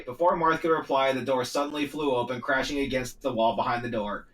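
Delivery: ordinary delivery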